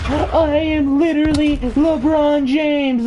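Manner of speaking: high, slow whiny brat yelling; bratty kid shout. Retold or imitated. Bratty kid insisting he's the greatest, high whiny shout. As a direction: shout back like a spoiled kid. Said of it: "And he says".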